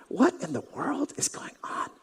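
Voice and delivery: whispered, bewildered question; confused whisper. Acted. Blind man wondering to himself, a confused whisper. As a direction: breathe it out in confusion.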